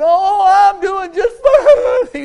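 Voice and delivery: loud, drawn-out wail breaking into sobs; crying through the words; mock crying. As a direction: imitate a tearful wail, high and slow. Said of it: "He started crying".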